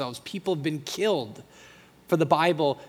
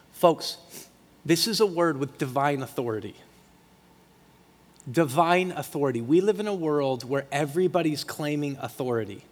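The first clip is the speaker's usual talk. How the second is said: ordinary delivery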